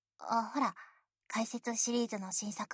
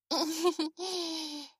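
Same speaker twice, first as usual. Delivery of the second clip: embarrassed giggle; 6 semitones higher than usual. Real, not acted. Giggles after cute voice.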